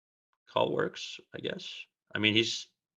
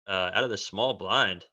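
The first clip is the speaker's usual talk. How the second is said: usual speaking voice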